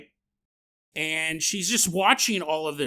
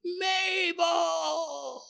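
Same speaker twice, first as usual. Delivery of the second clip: calling out; 9 semitones higher, 3 dB quieter, much slower than usual. Retold or imitated. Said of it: "he's going".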